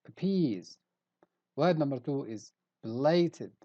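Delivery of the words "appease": "appease" with the weight on "pease"; light start, then lean into the end of "appease"; stress on second syllable. Shown as a demonstration. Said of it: "we stress the syllable peas".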